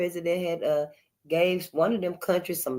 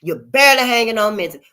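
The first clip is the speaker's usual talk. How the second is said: louder on barely hanging on; blunt imagined message; 7 dB louder than usual. Retold or imitated. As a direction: say it the blunt way, rising to loud. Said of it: "Instead of saying".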